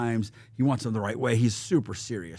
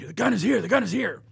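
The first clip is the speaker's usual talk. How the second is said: much higher excited mock announcement; announcing; 11 semitones higher than usual. Crew member announces the gun's arrival, high excited announcing. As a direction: herald it in a high voice, repeating it. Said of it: "They re-, announce the gun".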